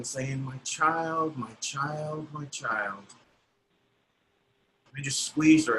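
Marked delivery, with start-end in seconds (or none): 0.4-3.1 mild retold sobbing, fading to soft. Cleaning woman repeats her plea to the conductor, softly fading and mildly tearful. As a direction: let the mother's plea fade away, lightly tearful. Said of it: "she just kept sobbing".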